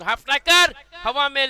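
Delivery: ball in the air, loud and high; excited call. Real, not acted. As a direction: shout as it flies.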